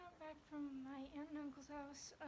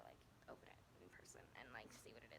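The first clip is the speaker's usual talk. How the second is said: whispering; 11 dB quieter, faster than usual. Retold or imitated. Whispered aside in quote.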